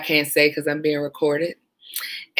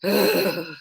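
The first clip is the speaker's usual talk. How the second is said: long, breathy mock sigh; imitated exasperated sigh; 4 dB quieter than usual. Irritated caller answers a woman, imitated heavy sigh. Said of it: "he just literally, like, sighed".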